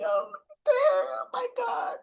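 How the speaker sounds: high, crying oh my God; breaking into tears over it; real crying. Real, not acted. Fan crying over Tracy on the stairs, high tearful wail. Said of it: "I started crying too".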